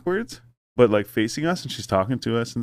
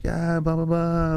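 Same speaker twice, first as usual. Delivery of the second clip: high, held babble mimicking French speakers; mock passers-by chattering in French, pitched up; imitating French chatter; 8 semitones higher, slower than usual. Passers-by greeting friends in French, imitated as high blah-blah. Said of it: "like, French stuff".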